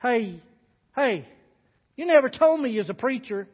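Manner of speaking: half-accuse the preacher; trace of hostility. Acted. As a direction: cook leaning over to challenge the preacher, mildly hostile. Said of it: "with a trace of hostility in his voice".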